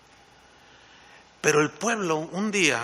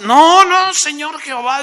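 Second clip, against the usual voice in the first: loud, much higher cry of protest; desperate plea; 11 semitones higher, 11 dB louder than usual. Retold or imitated. Moses crying out to God to spare the people, loud and high. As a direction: beg God to stop, loudly.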